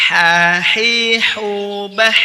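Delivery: Ha, hi, hu, bah said loudly with a clean airy h; clean H drill. Shown as a demonstration. Teacher drilling students on the letter H, loud clean h. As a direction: sound the h clean, no scrape. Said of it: "Perhatikan bersih ya".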